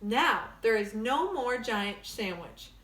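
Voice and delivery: ordinary delivery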